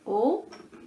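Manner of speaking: "Autobus" in three separated beats; syllable by syllable. Shown as a demonstration. Teacher confirming three syllables, syllable by syllable. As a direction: pause after each syllable of "Autobus". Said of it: "J'ai combien de syllabes".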